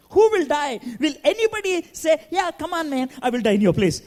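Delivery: high, disbelieving questions; incredulous prisoner. Retold or imitated. Condemned man laughing off the offer, high and incredulous. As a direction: scoff at the idea.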